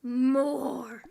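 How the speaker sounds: lightly voiced hungry creature. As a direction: bark it like a hungry fire spirit. Voicing a hungry fire creature.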